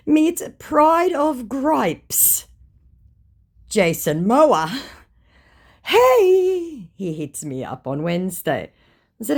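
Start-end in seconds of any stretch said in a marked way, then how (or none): 0.1-4.8 slow, high mock-grand announcement; mocking reveal. Mocking the scammer's name, slow. 5.8-6.9 high, loud cheesy hey; chirpy fake hello; mock scammer greeting. Scammer's opening line voiced.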